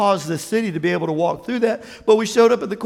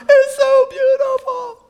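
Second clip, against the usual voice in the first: high, loud, drawn-out mock sobbing; hammed-up happy tears; mock crying; 5 dB louder, slower than usual. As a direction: slowly wail it, overcome with joy. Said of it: "they're crying because they get".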